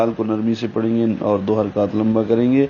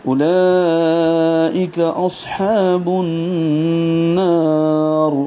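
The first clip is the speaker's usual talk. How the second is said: slow, higher chanted tajweed recitation; Quranic recitation; 5 semitones higher, much slower than usual. Shown as a demonstration. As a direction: recite the whole verse in long measured phrases. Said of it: "تو پورا یوں ہوگا".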